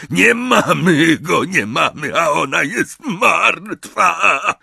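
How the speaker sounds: high, slow, drawn-out lament; despairing wail. A narrator's reading. Amisius beats his forehead over his sister, wailing. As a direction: wail in panic, stretching each word.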